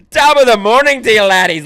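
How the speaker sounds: very loud, high mock-Irish greeting; Irish accent. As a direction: belt it out as an over-the-top Irish waitress. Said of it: "she's Irish".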